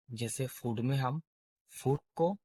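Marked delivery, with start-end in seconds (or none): 1.7-2.0 फूड spoken slowly, with a long clear oo; फूड demo, long oo vowel; model word food. Shown as a demonstration. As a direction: sound out फूड, stretching the oo. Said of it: "जैसे हम pronounce करते हैं".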